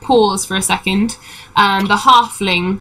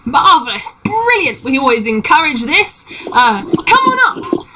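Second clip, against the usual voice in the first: high, enthusiastic welcome; delighted halfling; 5 semitones higher than usual. Acted. Halfling inviting travelers up the gangplank, high delighted call. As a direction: cheer them on, leaping up in pitch.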